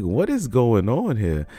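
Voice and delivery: incredulous, stretched-out reaction; baffled disbelief. Retold or imitated. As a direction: draw the question out in amused disbelief. Acted reaction to absurd game glitch.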